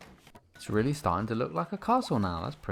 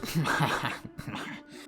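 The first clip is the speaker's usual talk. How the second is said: breathy chuckle; 4 semitones higher, 4 dB quieter than usual. Real, not acted. Laughing at own pun.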